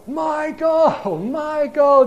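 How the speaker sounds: high, loud mock yell for a lost child; imitated yelling. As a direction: imitate a panicked dad calling out across a store. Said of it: "yelling at the top of his lungs".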